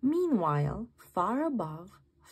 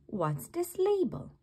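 curious slug; 8 semitones higher than usual. Acted. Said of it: "said Norman".

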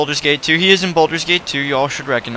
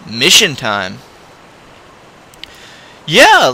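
ordinary talk, nothing marked

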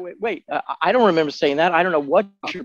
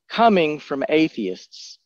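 ordinary talk

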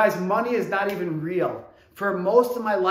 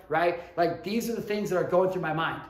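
normal talking voice

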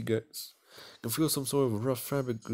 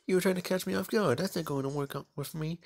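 indignant accusation; 7 semitones higher than usual. Acted. Embarrassed girl accusing the boy of lying, higher and sharp. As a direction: snap at him, flustered.

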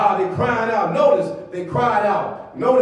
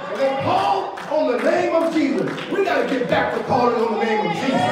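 rhythmic, raised preacher's cadence; impassioned pulpit exhortation; full-force preaching; 3 semitones higher than usual. Real, not acted. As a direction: rouse the congregation with driving repetition.

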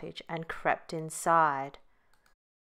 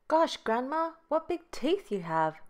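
gasping; 9 semitones higher than usual. A narrator's reading.

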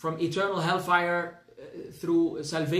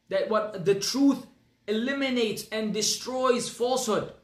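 preaching; 4 semitones higher than usual. Acted. Preaches plainness of Islam.

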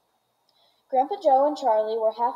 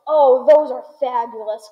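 much louder gushing exclamation; excited inventor crying out; delighted cry; 9 dB louder than usual. A narrator's reading. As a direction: burst out with delight. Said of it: "cried Mister Wonka".

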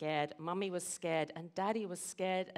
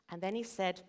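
usual speaking voice

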